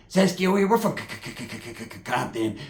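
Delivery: stammering homeboy, sputtering before the word lands; mock stutter. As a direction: get stuck on the C of Compton in a long stutter. Imitates homeboy stuttering out Compton.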